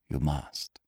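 low, hushed, compassionate doctor; quiet physician's tender insistence; pitying. A narrator's reading. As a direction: urge her softly and low. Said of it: "There was pity in his voice".

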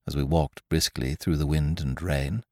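plain reading voice

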